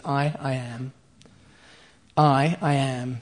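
slow, weighty "I, I am" with each word set apart; the divine name "I, I am", said slowly and solemnly; emphatic I am. Shown as a demonstration. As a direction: lean hard on "I, I am", spaced out. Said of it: "It's emphatic".

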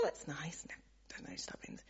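hushed aside, barely voiced; confiding whisper to the congregation. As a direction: whisper it as if to yourself. Private aside about the church.

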